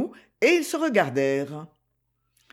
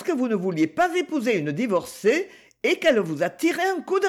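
put-on higher, lively girl's voice; higher, playful young girl; Gigi's voice; 6 semitones higher than usual. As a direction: play the teasing young girl greeting her uncle. Gigi voiced in read story.

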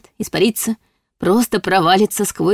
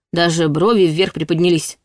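ordinary talk, nothing marked

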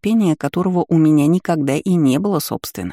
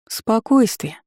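higher, cocky, mocking command; cheeky smirk; 5 semitones higher, much slower than usual. Heroine mocking the twisted aunt with a higher, cheeky command. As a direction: tease her with insolent calm. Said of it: "Нахально усмехнулась".

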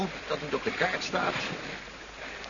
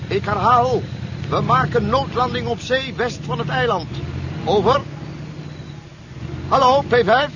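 loud, urgent radio call, rising on hallo; radio mayday call; 3 semitones higher, 4 dB louder than usual. Acted. Pilot calling base P five over the radio, loud and urgent. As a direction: call out to base through a failing set.

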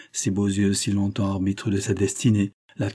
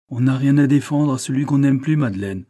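raised, louder reproach; defiant retort; 4 semitones higher, 5 dB louder than usual. A narrator's reading. Prosper rejecting Madeleine's command, defiant. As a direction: answer back with hurt defiance.

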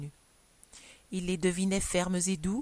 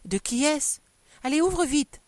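anxious urging, higher and louder; agitated, raised-voice exclamation; nervous cry; 7 semitones higher, 5 dB louder than usual. A narrator's reading. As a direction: press urgently for the news. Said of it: "avec la nervosité des femmes, s'écria".

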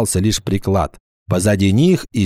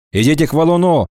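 high, sharp repeated order; commanding villain; 5 semitones higher, faster than usual. Acted. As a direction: bark the order again. Urthona repeats his order.

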